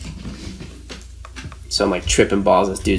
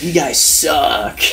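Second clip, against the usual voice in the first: laughing taunt; 5 semitones higher, much slower than usual. Retold or imitated. Drunk kid taunts trippers.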